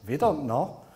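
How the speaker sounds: a hammed-up, lightly done German accent; mock German tech guy, lightly voiced; German accent, badly faked, "vi don't know". Shown as a demonstration. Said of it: "that wasn't a good German accent".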